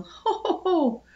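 high, loud mock ho-ho laugh; high teasing cackle of triumph. A narrator's reading. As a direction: crow it gleefully, high and laughing. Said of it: "said Penelope, laughing".

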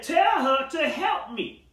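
high, slow, indignant demand; sassy demand. Acted. Martha to Jesus, sassy and indignant. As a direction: snap it out like a put-upon sister. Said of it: "Martha had a little sassy mouth".

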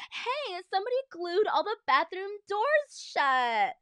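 high, loud outraged holler; high-pitched crowd yell; shouting. A narrator's reading. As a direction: yell it like a complaint from the stands. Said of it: "people shouting everything from".

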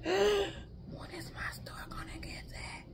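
breathless whisper after a gasp, slow; longing, wistful whisper; envious whisper. Retold or imitated. Imitating herself envying her sister's drinks, whispering.

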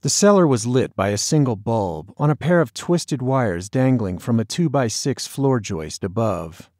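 ordinary delivery, nothing marked

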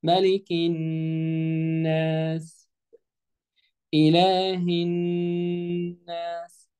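very slow tajweed chant; teacher's model recitation, drawn out; Quran recitation. Shown as a demonstration. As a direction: sustain the long vowels and hummed nn on one note. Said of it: "الآية اللي بعديها".

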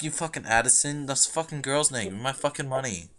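ordinary delivery

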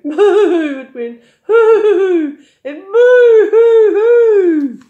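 high, loud mock boo-hooing; hammed-up crying moo and hoo; loud bawling. A narrator's reading. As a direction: wail like big men blubbing. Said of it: "There was a loud noise".

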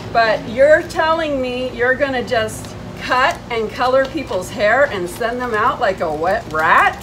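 ordinary talk

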